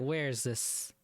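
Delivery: ordinary talk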